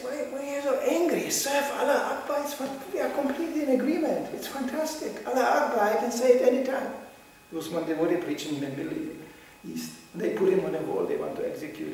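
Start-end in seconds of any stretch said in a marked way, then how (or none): 0.0-6.9 high, cheery mock invitation; mock cheery pitch. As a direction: coax them like a sunny zealot. Mocking cheerful preacher.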